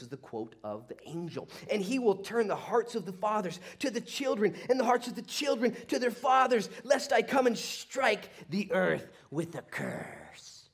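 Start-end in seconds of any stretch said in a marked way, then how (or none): 1.7-10.7 high, loud declamation slowing to the end; prophetic proclamation. Acted. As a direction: thunder out the warning, drawing out the last phrase. Proclaims the angel's quote.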